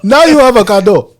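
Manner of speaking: loud, high mock African accent; imitated African accent, triumphant and loud. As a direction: deliver the punchline big in an African accent. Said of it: "little accents like that".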